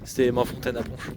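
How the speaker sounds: ordinary talk, nothing marked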